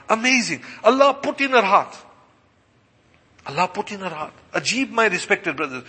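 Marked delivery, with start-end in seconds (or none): 0.1-1.9 higher-pitched exclamation of wonder; emphatic marvelling. Real, not acted. Marvelling, then repeats it subdued. 3.4-4.3 softer, reflective echo; hushed repeat. Real, not acted. Subdued repeat after exclaiming.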